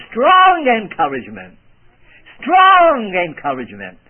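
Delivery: emphatic preacher stressing "strong". Shown as a demonstration. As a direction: lean hard on "strong", twice. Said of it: "may I emphasize the word strong".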